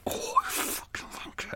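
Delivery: slow, whispered curse near tears; furious whisper. Real, not acted. Vlogger cursing noisy neighbour, slow whispered fury. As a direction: spit it out under the breath in exasperation. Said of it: "really furious".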